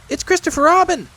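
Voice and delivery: high, loud little-animal squeak; Piglet exclaiming. Acted. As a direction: pipe up excitedly, high and loud. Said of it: "said Piglet".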